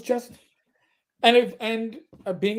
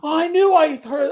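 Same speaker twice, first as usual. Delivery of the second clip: excited recognition; 9 semitones higher, 7 dB louder than usual. Retold or imitated. Retold delighted recognition of accent.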